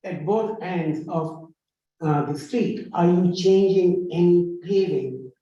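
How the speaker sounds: slow, spaced-out question with pauses; deliberate, half-speed repeat of a question; very slowly. Real, not acted. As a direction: say it slowly for someone who can't hear. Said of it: "let me say it very slowly".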